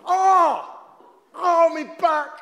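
loud pained groan; stretching groan. Acted. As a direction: cry out as your back goes. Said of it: "My back's gone".

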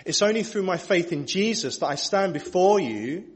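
ordinary talk

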